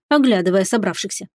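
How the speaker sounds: ordinary delivery, nothing marked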